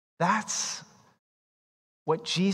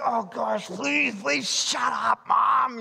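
put-on embarrassed teen; 6 semitones higher, slower than usual.

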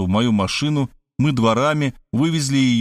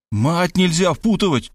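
high, frantic yell; furious yell; 8 semitones higher than usual. Acted. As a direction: explode in rage at the suggestion. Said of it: "сатанея завопил я". (яростный крик)

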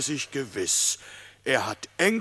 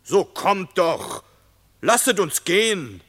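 impatient calling; 6 semitones higher, 6 dB louder than usual. A narrator's reading. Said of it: "Ungeduldig begann Bellin am Tore zu schmälen".

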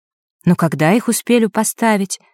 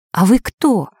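ordinary delivery, nothing marked

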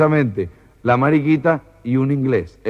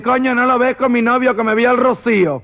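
high, exasperated comic voice; hammed-up indignant high pitch; camp retort; 10 semitones higher than usual. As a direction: answer back in a high camp character voice. Camp protester retorts from the horse.

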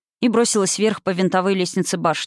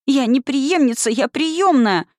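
higher, emphatic protest in alarm; frightened protest; 6 semitones higher, slower than usual. A narrator's reading. Scared girl denies the witch's accusation, alarmed protest. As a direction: deny it with rising distress. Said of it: "запротестовала перепуганная девочка".

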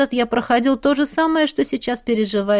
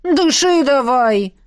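slow firm coaching; 4 semitones higher, 4 dB louder, slower than usual. Acted. Coaching through contraction.

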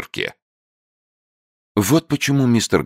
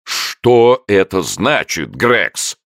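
higher, louder, slow demand; angry shout; 7 semitones higher, 5 dB louder, much slower than usual. A narrator's reading. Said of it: "крикнул сэр Чарльз".

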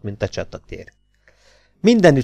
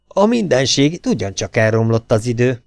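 plain reading voice

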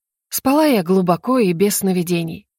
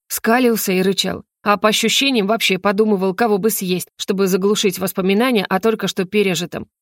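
ordinary delivery

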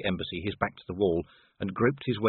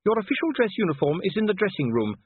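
high-pitched, no-nonsense instruction; firm wife; 10 semitones higher than usual. A narrator's reading. Sybil directs Vimes to his uniform, firm high voice. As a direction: tell a husband firmly what to wear.

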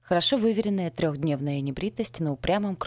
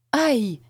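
loud, high cry of pain; yelp of pain; 8 semitones higher, 7 dB louder than usual. A narrator's reading. Narrator is pulled apart by two people, pained yelp. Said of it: "вырывается из глубины души вопль".

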